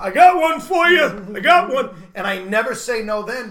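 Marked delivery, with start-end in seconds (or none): 0.0-1.9 loud, higher excited call; eager old man. Retold or imitated. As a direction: shout it out like a keen old fan. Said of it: "old men will come by".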